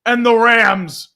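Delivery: high, loud ecstatic shout; touchdown call. Real, not acted. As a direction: scream the touchdown like a hyped announcer. Touchdown call, hyped announcer.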